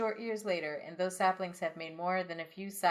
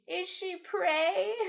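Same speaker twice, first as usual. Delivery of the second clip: high ceremonial elder voice; town elder voice; 12 semitones higher, 4 dB louder, much slower than usual. Acted. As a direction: proclaim it like the old elder. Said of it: "The elder addresses the town".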